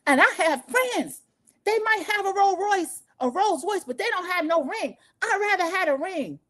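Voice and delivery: high, whiny imitation of a yelling rival; mock shrill rant. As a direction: mimic her indignant wail. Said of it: "Contessa is screaming and yelling".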